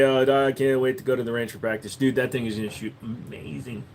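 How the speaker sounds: ordinary talk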